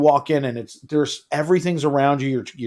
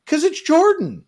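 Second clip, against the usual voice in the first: ordinary talk, nothing marked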